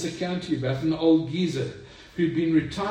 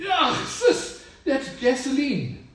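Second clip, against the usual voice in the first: high startled cry, louder; 10 semitones higher, 4 dB louder, slower than usual. Acted. Doctor tasting gasoline, high startled cry. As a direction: yelp at the taste. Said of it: "the doctor cries out and says".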